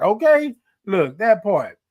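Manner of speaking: usual speaking voice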